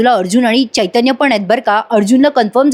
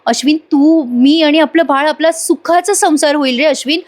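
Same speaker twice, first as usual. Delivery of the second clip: higher, dreamy then urgent heroine voice; Priya pleading; 5 semitones higher than usual. Acted. Priya promising Ashwin a happy home, high and pleading. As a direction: swear on the baby, begging. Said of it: "Priya म्हणत असते".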